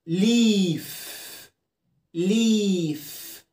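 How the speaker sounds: ليف with a drawn-out ee; ليف, long ee like a held ya. Shown as a demonstration. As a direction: stretch the ee in ليف very slowly. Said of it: "بتعمل برضو لونج اي زي المد بالياء".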